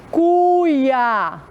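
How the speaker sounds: "cuia" stretched out as cu-ia; syllable-by-syllable reading. Shown as a demonstration. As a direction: sound out "cuia" slowly for beginners. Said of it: "Vamos ler com a tia".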